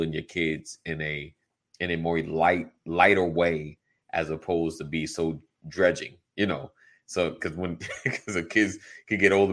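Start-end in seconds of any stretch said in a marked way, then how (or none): none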